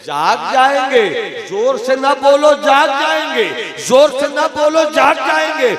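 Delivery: high, loud repeated plea; urgent warning. Acted. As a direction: warn urgently with rising insistence. Saint urgently warns woman, repeating. (घबराई हुई ऊँची चेतावनी)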